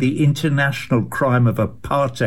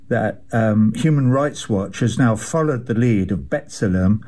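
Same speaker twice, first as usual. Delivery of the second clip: ordinary delivery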